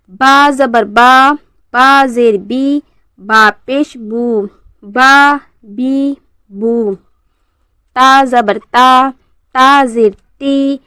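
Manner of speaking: very slow, drawn-out syllable drill; measured spelling-out for learners to copy; model recitation for repeating. Shown as a demonstration. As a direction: recite each letter and vowel deliberately, holding the long sounds. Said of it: "जिस तरीके से मैं अदा करूँगी".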